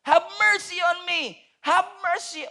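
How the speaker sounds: high, loud pleading cry. Retold or imitated. Blind man shouting at Jericho, loud high plea. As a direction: beg loudly, slow. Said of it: "sigaw siya ng sigaw".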